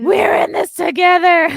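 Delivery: shouted high and loud; mock shout. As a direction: yell it like a frantic protester. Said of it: "she's sweating and looking dead".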